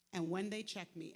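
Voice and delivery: normal talking voice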